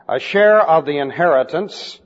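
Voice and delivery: normal talking voice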